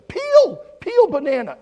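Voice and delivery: high, loud, drawn-out urging; screaming children. Retold or imitated. Children telling the pastor to peel it, yelling. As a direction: shout it like exasperated kids. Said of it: "they all started screaming".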